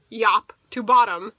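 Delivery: usual speaking voice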